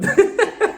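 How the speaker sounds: high, loud burst of laughter; real laugh. Real, not acted. Host mocking the protective husband, real laughter. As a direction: crack up at your own impression.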